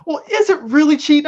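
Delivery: high-pitched mock innocent question; mock Jedi mind trick. Mimicking women who deny they cheat, coy and high. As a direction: play the coy doubter, pitched well up. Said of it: "pull the Jedi mind trick to be like".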